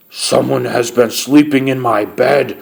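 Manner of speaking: great big bear voice, restrained roar; roaring Papa Bear. A narrator's reading. Papa Bear finds his bed slept in, roaring. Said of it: "roared Papa Bear in his great big voice".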